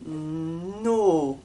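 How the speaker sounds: no with the tongue pulled back for a round O; model no. Shown as a demonstration. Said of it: "Et la langue recule".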